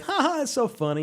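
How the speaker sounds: high mock laugh, quoting him; imitated amusement. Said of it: "He's like".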